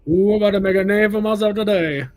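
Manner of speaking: high, loud performed line; put-on character voice. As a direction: belt it out as a hammy impression. Mimicking a character after film talk.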